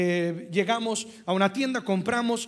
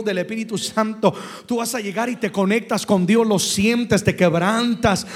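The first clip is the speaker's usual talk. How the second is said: loud, rising sermon climb, louder to the end; preaching at full force; 7 dB louder than usual. Acted. Pastor to the congregation, loud full-force preaching. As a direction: preach it out to the whole church.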